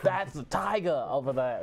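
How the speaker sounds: slow, put-on accent that drifts off Australian; failed Australian accent. Shown as a demonstration. Host attempts an Australian accent that misfires, a mock accent. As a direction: attempt Australian but miss it.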